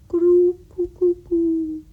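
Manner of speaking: high, loud, drawn-out dove coo; fluting bird call on a held high note. A narrator's reading. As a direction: imitate a dove calling slowly. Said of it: "sounded from the quiet".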